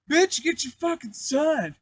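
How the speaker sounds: high, loud, drawn-out mock yell; imitated slow, high-pitched cussing-out; retold angry demand. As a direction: act out an indignant holler.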